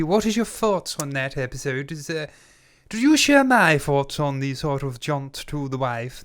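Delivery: put-on posh English, plummy boxer-meets-actor voice; theatrical posh English accent; posh English gentleman accent. Shown as a demonstration. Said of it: "in this posh English gentleman a-, accent".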